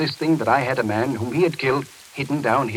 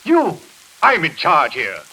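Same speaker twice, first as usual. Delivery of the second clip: high, loud indignant outburst; outraged fury; 7 semitones higher, 5 dB louder than usual. Acted. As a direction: roar that no one defies him. Doctor furious at being defied.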